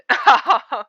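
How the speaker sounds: loud, high burst of laughter; self-mocking laugh. Real, not acted. Laughs after including herself in jab.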